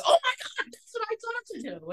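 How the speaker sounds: very high mock squeal of delight; mock scream. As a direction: squeal it high, thrilled it worked. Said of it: "Sharon literally screamed".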